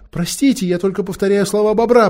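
flustered, frightened excuse; stammering mumble. A narrator's reading. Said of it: "пробормотал, заикаясь, Эдмунд".

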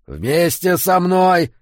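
loud, high, drawn-out roar; growled command. A narrator's reading. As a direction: roar it out slowly to rally him. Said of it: "зарычал Эммет". (рычащая команда)

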